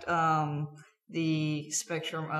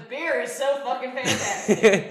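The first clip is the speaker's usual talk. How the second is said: mock drunk quote; 5 semitones higher, 7 dB louder than usual. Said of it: "Dwayne goes".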